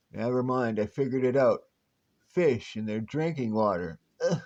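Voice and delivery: ordinary talk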